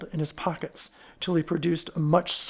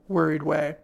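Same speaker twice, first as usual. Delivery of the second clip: ordinary delivery, nothing marked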